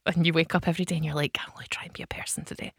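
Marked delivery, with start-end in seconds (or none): 1.4-2.7 fast, breathless whisper to yourself; whispered inner thought. Retold or imitated. Voicing her own morning resolve to herself, in a quick whisper. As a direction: hush it like a secret pep talk.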